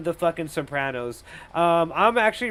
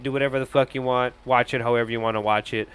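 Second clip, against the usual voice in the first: ordinary talk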